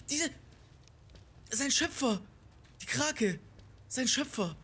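high, loud astonished exclaiming; breathless disbelief at what happened; real overjoyed shock; overwhelmed excitement. Real, not acted. Stunned after boss dies.

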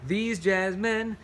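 slow, sing-song picture-book verse; reading to children. Acted. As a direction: lean into the rhythm of the rhyme. Reads picture book aloud.

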